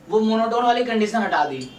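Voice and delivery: ordinary talk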